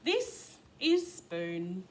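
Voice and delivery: plain reading voice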